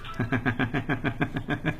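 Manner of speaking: soft, rhythmic chuckle; quiet laugh in quick, even pulses. Real, not acted. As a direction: laugh softly to yourself. Laughs after reading listener's thanks.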